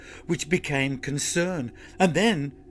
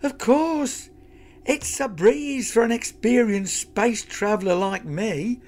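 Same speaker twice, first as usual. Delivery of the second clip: high robot sidekick voice; 9 semitones higher, 4 dB louder than usual. Acted.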